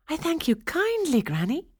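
high, delighted princess voice; delighted cry. A narrator's reading. Princess thanks the old woman for her gift, delighted. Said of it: "she cried".

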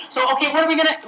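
ordinary delivery

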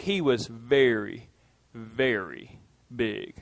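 low, slow, hushed "very, very big"; drawn-out dramatic hush on the repeat; hushed emphasis. Shown as a demonstration. Preacher shows repetition for emphasis, hushed and slow. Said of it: "We, we emphasize things this way".

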